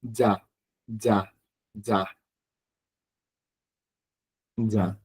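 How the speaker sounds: Ya, ya, ya with a soft Y sound, fading; double-L sound. Shown as a demonstration. Teacher showing the middle-of-word sound. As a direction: say ya like a Spanish double L. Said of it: "como una doble L".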